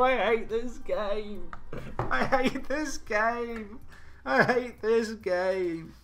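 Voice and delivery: very slow, high, drawn-out whine; anguished whine. Real, not acted. Gamer whining in despair after conceding. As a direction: moan it like the world has ended.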